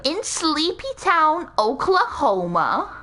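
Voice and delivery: slow, stretched-out podcast narration; true-crime podcaster. Acted. Setting the scene of a crime, true-crime podcaster voice. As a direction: start high, draw out the place name. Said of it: "is that your true crime podcaster voice".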